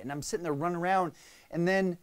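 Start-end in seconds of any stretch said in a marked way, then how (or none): none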